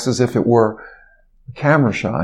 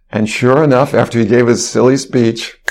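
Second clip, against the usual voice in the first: ordinary talk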